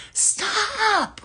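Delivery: high-pitched, drawn-out mock scream; imitated scream. Young lady screaming at her tormentors, imitated scream. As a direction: imitate a girl screaming out at them. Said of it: "she screamed out to them".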